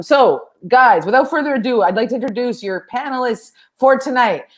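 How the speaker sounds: ordinary talk, nothing marked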